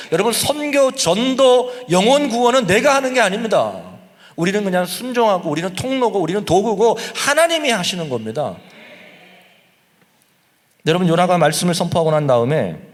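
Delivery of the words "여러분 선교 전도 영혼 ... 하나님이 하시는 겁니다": loud and high, hammering each phrase; preaching at full force. Acted. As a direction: proclaim it like a rising sermon climax.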